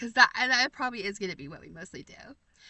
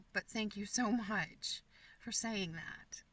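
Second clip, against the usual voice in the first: softer, slow, whispered thanks; touched whisper; 2 semitones lower, 8 dB quieter, slower than usual. Real, not acted. Thanking viewer for calling her inspiring, whispered. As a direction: breathe the thanks out, moved.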